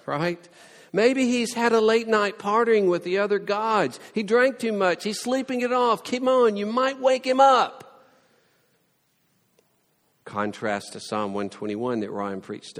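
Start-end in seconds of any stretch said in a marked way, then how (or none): 0.9-8.1 high, loud teasing; mocking taunt. Elijah teasing Baal's priests, loud high mockery. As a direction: rib them about the sleeping god.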